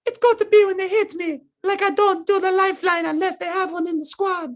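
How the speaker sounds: impersonating the Apex hero; Lifeline voice. Retold or imitated. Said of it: "That was a lifeline accent?".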